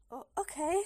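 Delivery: a touch of nerves; slightly nervous. A narrator's reading. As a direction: read it as a slightly uneasy agreement. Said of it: "sagte ich etwas nervös".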